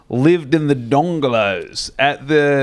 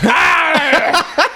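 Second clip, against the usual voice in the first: angry yell; 5 dB louder than usual. Retold or imitated. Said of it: "And he's like".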